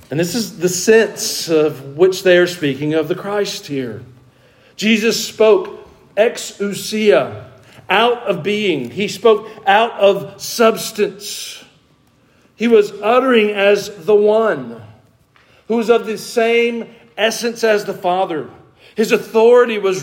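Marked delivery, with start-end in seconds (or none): none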